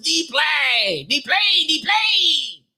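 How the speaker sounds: high, put-on voice stretching out each D-plane; mocking imitation. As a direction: mimic him like a taunting kid. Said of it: "it was the little motherfucker".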